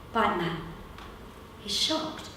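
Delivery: usual speaking voice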